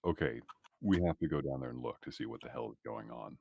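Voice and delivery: usual speaking voice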